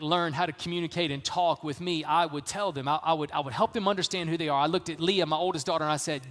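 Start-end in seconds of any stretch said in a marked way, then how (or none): none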